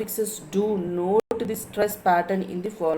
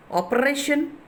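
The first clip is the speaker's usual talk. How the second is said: operation with a higher, stressed RATION; 5 semitones higher than usual. Shown as a demonstration. Teacher showing students the stress, operation with RATION lifted. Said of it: "ration is stressing".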